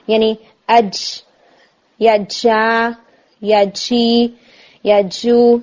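اج, جا, جی, جو with a weak hit and leaking air; faulty airy jeem; loose jeem. Shown as a demonstration. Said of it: "یہ sound آ رہی ہوتی ہے".

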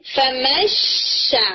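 فمشا drawn out, the n hidden in a sh-coloured nasal; ikhfa demo. Shown as a demonstration. Teacher answering how to read it, slow nasal. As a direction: stretch فمشا slowly with a nasal hum. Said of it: "آپ اسے کیسے پڑھیں گے".

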